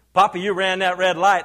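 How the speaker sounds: mock accusation. Grandkids catch Papa running a light, loud accusing. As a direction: call Papa out loudly.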